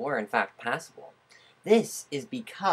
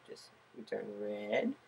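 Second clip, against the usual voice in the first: usual speaking voice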